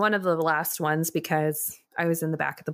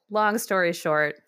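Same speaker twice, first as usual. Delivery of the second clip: ordinary talk, nothing marked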